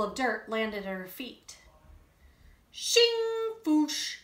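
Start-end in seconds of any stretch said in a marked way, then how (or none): 2.7-4.2 high, loud shovel sound effects; digging noises. Acted. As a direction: voice the spoon digging with playful noises.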